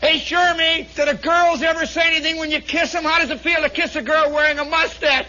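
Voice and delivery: high, loud rapid heckling; jeering question. Acted. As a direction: needle him in front of everyone. Loud mocking question to Shermy.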